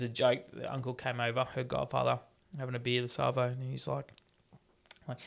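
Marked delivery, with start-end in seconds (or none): none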